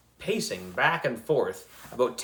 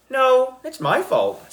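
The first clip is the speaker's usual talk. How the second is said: insistent protest; 12 semitones higher, 5 dB louder, slower than usual. A narrator's reading. Chaperone insists it's her fault.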